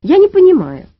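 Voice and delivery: громко и высоко, с недоумением; puzzled, pitched well up and loud; удивление. A narrator's reading. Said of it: "удивилась Маня". (удивлённо)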